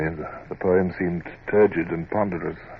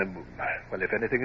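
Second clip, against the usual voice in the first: normal talking voice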